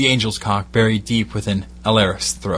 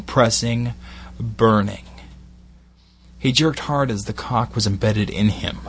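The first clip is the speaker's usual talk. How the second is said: usual speaking voice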